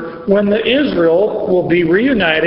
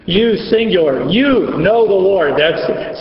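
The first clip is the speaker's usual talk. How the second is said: usual speaking voice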